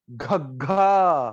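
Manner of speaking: घग्घा said slowly, breathy gh pushed from the chest; forceful gh sound. Shown as a demonstration. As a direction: push घग्घा out from the chest, stretched. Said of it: "कैसे बोलेंगे इसको?". (घग्घा को छाती से ज़ोर देकर धीरे बोलो)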